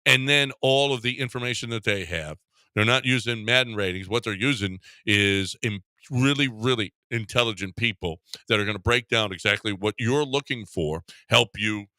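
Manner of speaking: upbeat host-read sponsor spot. Acted. Radio host reading a live spot for Game Day Men's Health. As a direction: sell it like a radio ad.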